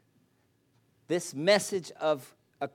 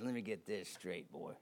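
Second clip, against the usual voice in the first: lightly voiced father; 7 semitones lower, 11 dB quieter than usual. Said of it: "my dad talked like this".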